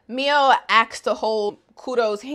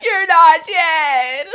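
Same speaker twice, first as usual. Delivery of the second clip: high, loud, half laughing, half crying; overjoyed reunion, voice breaking with a laugh; excited; 9 semitones higher, 7 dB louder than usual. Retold or imitated. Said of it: "Kudo is really excited".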